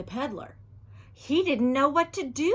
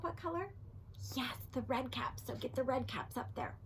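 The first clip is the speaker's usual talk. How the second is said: soft whispered prompts; 12 dB quieter, faster than usual. Real, not acted.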